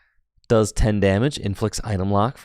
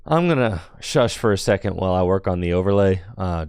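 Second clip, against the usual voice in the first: ordinary delivery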